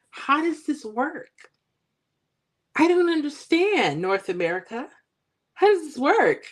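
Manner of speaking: high-pitched, baffled questioning; bewildered. Real, not acted. Streamer puzzled by other states' lottery rules, high and bewildered. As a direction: pitch it way up in exasperated confusion. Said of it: "I'm bewildered".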